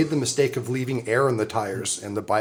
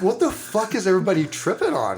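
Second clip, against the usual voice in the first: laughing confusion; 7 semitones higher than usual. Retold or imitated. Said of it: "I'm like".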